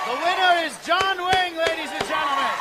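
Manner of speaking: loud, high game show announcement; announcer shout. Real, not acted. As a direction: announce the winner with full voice. Host announces winner.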